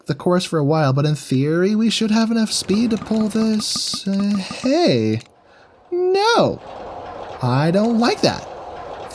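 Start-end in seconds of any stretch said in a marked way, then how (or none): 4.1-9.1 high whiny complaining. Real, not acted. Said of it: "It triggered my whiny voice".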